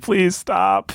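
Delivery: high, hammy pleading; dramatic movie plea. Retold or imitated. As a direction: overplay a desperate plea. Mocking the film.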